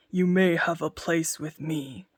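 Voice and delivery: lordly giant offering a job. Acted. Giant speaks.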